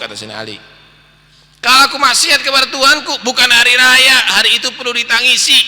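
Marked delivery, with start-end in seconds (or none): 1.6-5.6 high, loud impassioned words; fervent declaration. Acted. Preacher voicing Ali's vow to the congregation, high and loud. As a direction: declare it with burning conviction.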